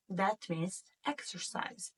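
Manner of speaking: ordinary talk, nothing marked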